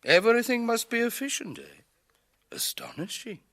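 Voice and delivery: scoffing, incredulous repeat; sarcastic disbelief. Acted. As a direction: throw the word back at him in scorn. Mocking the engineer's efficiency talk.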